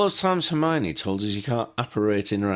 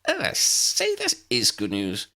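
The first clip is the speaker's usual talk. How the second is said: delighted. Acted. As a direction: burst out, thrilled. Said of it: "astonished and delighted".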